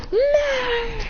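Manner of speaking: one long, high wail; high-pitched wail. Acted. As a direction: hold a high wordless cry. Acts out the Saiyan's cry.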